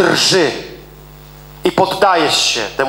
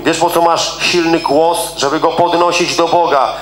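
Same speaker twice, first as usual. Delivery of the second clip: ordinary talk, nothing marked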